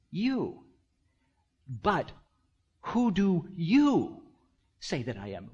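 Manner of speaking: YOU set first and alone, then long and high again; slow, emphatic YOU, dropping away after it; stress on YOU. Shown as a demonstration. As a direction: lean hard on both YOUs, pausing between phrases. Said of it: "the Greek sentence structure goes like this".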